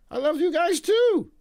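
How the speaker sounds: squeaky high, loud fake sob voice; hammed-up tearful thanks; mock crying. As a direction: wail it like you're about to cry. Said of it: "I'm gonna cry a little bit".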